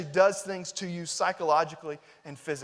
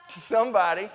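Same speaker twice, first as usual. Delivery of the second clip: said louder; 5 dB louder than usual. Real, not acted. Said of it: "say it louder".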